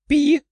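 short rubbery squeaky toy peep; high squeak. Acted. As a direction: squeak once, bright and agreeing. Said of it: "утвердительно пискнул зверь".

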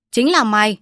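loud, sudden accusation; Văn Náo accuses the servant with a loud shout; accusing shout. Acted. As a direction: point the knife and bark it. Accusing with a knife.

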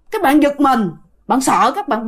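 ordinary talk

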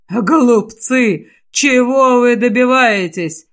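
slow, mocking demon voice; a demon taunting its foes. The eighth seal sneers at the heroes in a demon voice.

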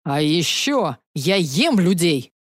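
slow, stubborn monster voice; a dragon insisting, booming. Acted. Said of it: "гигант упрямо продолжил".

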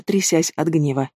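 usual speaking voice

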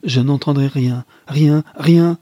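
loud, high, building refusal; frantic refusal to listen; crying out. Acted. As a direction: shout over her, rising with each repeat. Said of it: "s'écria-t-il".

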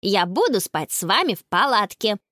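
ordinary delivery

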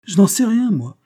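high, loud protest; exasperated. A narrator's reading. Flustered friend answered by narrator.

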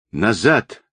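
understated commanding call; imperious yell. A narrator's reading. Rogov orders his people back, mildly imperious yell. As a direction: order them back with restrained authority. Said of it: "властно заорал Рогов".

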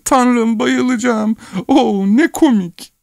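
laughing wife. A narrator's reading. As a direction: gasp it out while laughing. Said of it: "kahkahalarla gülüyordu". (kahkahalar arasında tiz sesle)

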